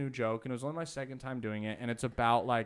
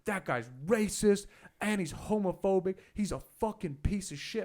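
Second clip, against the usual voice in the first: higher, louder mock rant; retold angry yelling; 6 semitones higher, 4 dB louder than usual. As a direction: imitate a bartender yelling accusations. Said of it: "I hear him yelling".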